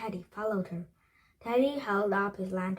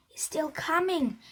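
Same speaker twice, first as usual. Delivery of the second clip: a contained, narrated shriek, pitched up; mild shriek; 7 semitones higher than usual. A narrator's reading. Jack warns the others the bones still follow, mild shriek. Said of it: "he shrieked".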